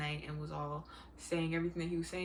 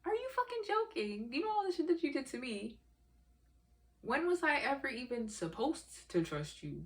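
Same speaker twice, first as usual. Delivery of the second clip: outraged disbelief; 7 semitones higher than usual. Real, not acted. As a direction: pitch shoots way up in outrage. Furious disbelief at boyfriend.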